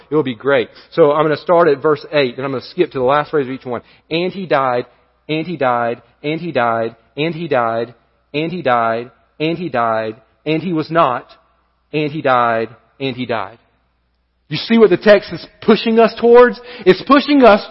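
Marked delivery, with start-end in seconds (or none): 4.1-13.6 softer, lower, measured "and he died" repeated; rhythmic scripture refrain. Shown as a demonstration. Preacher reading the genealogy aloud to the church, solemn and rhythmic. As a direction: read each "and he died" as a solemn toll. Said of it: "I want you to listen as I read".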